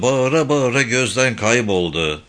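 plain reading voice

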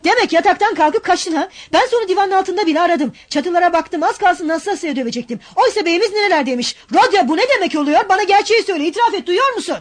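high, loud furious scolding; angry scolding. Acted. Razumihin scolding his runaway sick friend, angry. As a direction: berate him like a frantic friend.

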